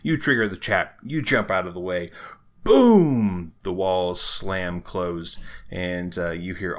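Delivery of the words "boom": sudden loud, high "boom"; booming impact. Acted. Game master narrating the trap going off, explosive boom. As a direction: imitate a trap slamming shut with a big boom.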